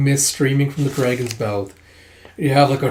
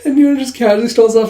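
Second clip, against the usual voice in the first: amused; 9 semitones higher, 6 dB louder than usual. Real, not acted. Laughing at the funny scene.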